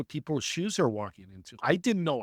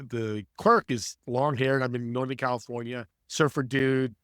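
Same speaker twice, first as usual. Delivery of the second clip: normal talking voice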